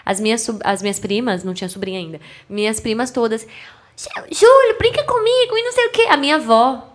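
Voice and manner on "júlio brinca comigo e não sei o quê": mock little girl voice, much higher; imitating small cousins begging; voz de criança. Primas pequenas pedindo para brincar. (voz fininha de criança pedindo)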